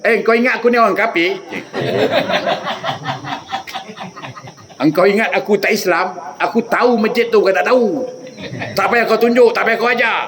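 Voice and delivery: a mild imitated outburst, laughing at first; hammed-up grumpy host; mock angry retort. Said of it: "Marah dia". (marah-marah olok-olok)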